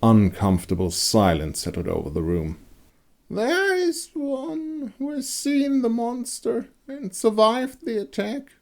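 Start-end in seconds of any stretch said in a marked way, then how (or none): 3.3-8.5 put-on much higher, slow old crone voice; high raspy crone drawing out her words. A narrator's reading. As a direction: creak it out like an old hag. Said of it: "the crone spoke up after a moment in a raspy voice".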